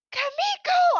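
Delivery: high, loud shout of a name; retold yell. Teacher retells shouting at girl with dead mouse, imitated yell. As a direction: imitate a startled teacher yelling at a child. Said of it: "We were yelling".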